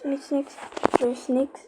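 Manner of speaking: usual speaking voice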